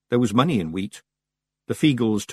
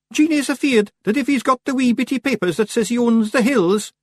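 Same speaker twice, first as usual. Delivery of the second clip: very high, loud Scottish figure; Billy Big Chin worrying; 5 dB louder than usual. A narrator's reading. Billy Big Chin passing on Jeannie's fear, high-pitched worry. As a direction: pipe up anxiously, far higher.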